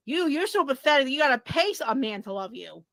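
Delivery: usual speaking voice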